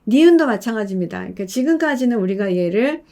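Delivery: ordinary talk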